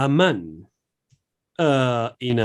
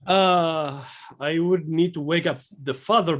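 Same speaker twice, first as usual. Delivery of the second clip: ordinary delivery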